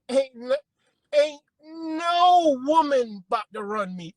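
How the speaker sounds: a proud, slow, loud boast; defiant declaration. Real, not acted. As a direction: lean hard on every word, refusing to be run. Defiant boast about his manhood.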